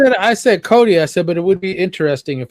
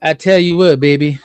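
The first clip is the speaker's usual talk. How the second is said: Dusty Rhodes impression; 3 semitones higher, 8 dB louder than usual. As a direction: ham it up lightly like the old wrestler hyping a promo. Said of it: "in his Death to Rhodes accent".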